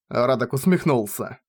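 ordinary delivery

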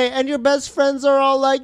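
ordinary delivery

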